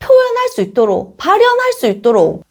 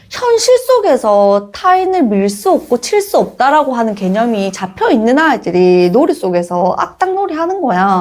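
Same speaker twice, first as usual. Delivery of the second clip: ordinary talk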